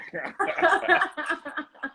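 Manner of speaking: real high-pitched giggling fit; long, helpless high laugh; high laughter. Real, not acted. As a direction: crack up at your own joke. Cracks up at own joke.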